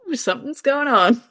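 a high, amused ooh of noticing; mock surprise, curious not hurt; light surprised ooh. Mother reacting to daughter's rude gesture.

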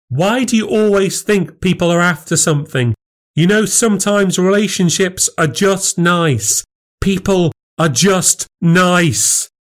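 high, emphatic protest; exasperated arguing. Acted. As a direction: plead your point in frustration. Wife argues with husband.

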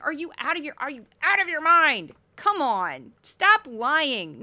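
loud, exasperated reenacted yelling; screaming at the TV. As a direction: shout in disbelief at the television. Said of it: "why I was screaming at my T V".

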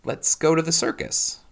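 high, stiffly clicked-out words; awkward echo of someone else's excitement, pitched high; self-conscious. A narrator's reading. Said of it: "with a self-consciousness that made her wince".

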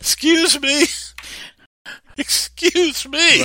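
put-on a stunned girl protesting to the angel; incredulous Mary. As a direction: squeak it out in total disbelief.

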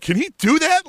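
put-on high, loud startled kid voice; kid asking coach. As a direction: squeak it out like a confused kid. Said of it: "He's looking over his coach, like".

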